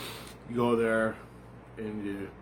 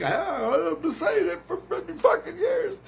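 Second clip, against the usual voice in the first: very high, squealed protest; acted exasperated outburst; 3 dB louder than usual. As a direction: cry it out shrilly, overwhelmed and vindicated. Acting out his exasperated, vindicated reply.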